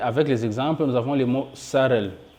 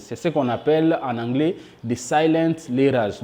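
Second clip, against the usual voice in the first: ordinary delivery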